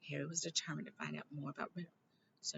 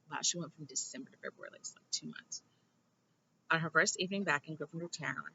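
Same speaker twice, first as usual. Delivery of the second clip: ordinary delivery, nothing marked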